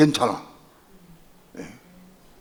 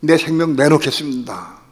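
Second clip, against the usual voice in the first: fervent vow; 3 semitones higher, 10 dB louder than usual. Retold or imitated. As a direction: cry out a vow of surrender. Vow in prayer. (목 놓아 외치는 서원)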